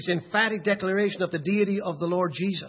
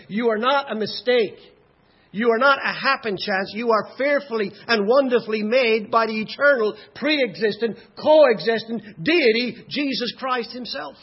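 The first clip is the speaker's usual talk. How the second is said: raised voice, hammering each title; impassioned preaching; 4 semitones higher, 6 dB louder than usual. Acted. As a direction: build with emphatic pulpit force. Preaches with rising force.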